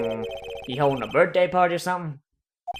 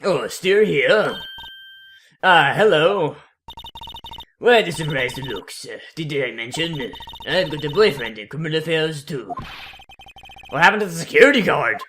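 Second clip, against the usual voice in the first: put-on old Japanese man voice. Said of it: "old Japanese man voice".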